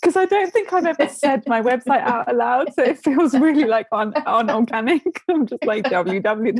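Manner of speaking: talking through laughter, pitch lifted; real giggling. Real, not acted. Guest embarrassed saying website aloud, giggling. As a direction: get it out between giggles. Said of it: "I'm laughing so much".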